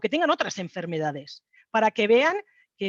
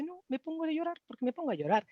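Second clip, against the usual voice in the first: lightly voiced child, higher and softer; a hint of a puzzled eleven-year-old girl; daughter's voice; 7 semitones higher, 6 dB quieter than usual. Mother imitates her crying daughter's answer, lightly higher-pitched. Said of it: "Y dice".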